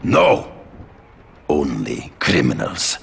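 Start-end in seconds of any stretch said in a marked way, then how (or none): 0.0-0.5 sudden high shouted denial; loud indignant no; sharp no. Acted. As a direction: cut the accusation off with a loud no. Denies hunting normal people.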